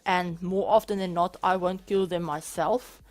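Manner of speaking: ordinary delivery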